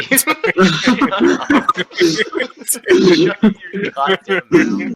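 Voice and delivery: loud, high-pitched mock yelling; mock fury. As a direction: shout down the teasing, half in jest. Mock telling-off amid laughter.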